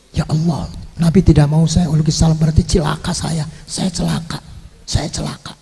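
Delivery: soft, understated imitation of a weeping lament; meniru sahabat menangis. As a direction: lament quietly, fading almost to nothing. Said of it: "Nangis sahabat". (meratap pelan, meniru orang menangis)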